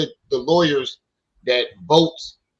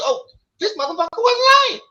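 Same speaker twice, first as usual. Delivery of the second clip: much higher, delighted disbelief; amazed; 4 dB louder than usual. Real, not acted. Telling his sister about the dismissal, amazed and high. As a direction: gasp it out in delight.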